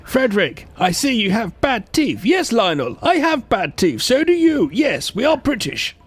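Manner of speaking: high, hammed-up posh British accent; posh British skit. Co-host mocking Brits with bad teeth, high posh British accent. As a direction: play two toffs trading lines in a silly high British accent.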